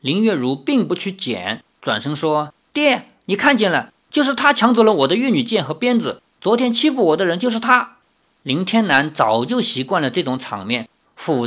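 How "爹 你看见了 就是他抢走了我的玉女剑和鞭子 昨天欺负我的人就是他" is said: high, quick tattling to father; accusing daughter. Acted. Daughter accusing the young man to her father, high and quick. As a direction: point him out indignantly.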